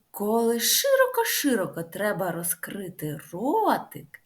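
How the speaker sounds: ordinary talk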